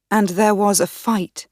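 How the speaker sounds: ordinary delivery, nothing marked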